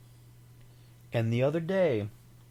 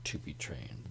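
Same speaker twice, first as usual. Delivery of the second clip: whispering; 9 semitones lower, 10 dB quieter than usual. Real, not acted. Unvoiced whisper.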